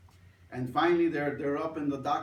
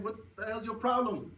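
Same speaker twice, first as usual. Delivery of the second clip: a mild mock yell at the kid; mock angry yell; 7 semitones higher than usual.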